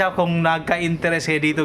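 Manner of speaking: ordinary talk, nothing marked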